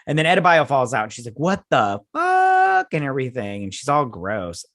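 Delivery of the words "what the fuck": high, loud, drawn-out shock; high-pitched stretched exclamation; shocked outburst. Retold or imitated. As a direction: act out stunned disbelief at full pitch. Acts out her shocked reaction.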